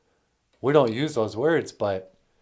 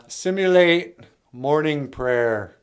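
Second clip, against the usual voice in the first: usual speaking voice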